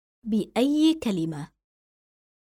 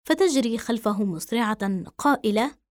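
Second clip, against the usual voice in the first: ordinary delivery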